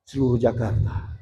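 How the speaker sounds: ordinary delivery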